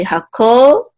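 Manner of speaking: hakohanim with ko held long and rising; secondary accent on ko. Shown as a demonstration. Teacher showing the accent marks, hakohanim with a long ko. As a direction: stretch ko upward, then land on nim. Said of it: "This is a secondary accent mark".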